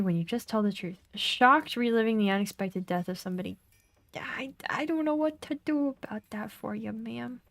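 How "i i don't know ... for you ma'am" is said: put-on whispered, half-hearted British accent; a bad British accent, barely voiced. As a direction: hush it in a wobbly fake British accent. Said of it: "Sounds like a bad British accent".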